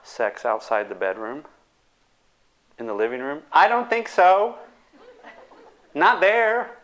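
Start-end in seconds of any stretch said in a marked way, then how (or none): 3.5-6.8 higher, louder balking no; a prim mock objection; reluctant refusal. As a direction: push back, half-shocked. Reluctant partner refusing location.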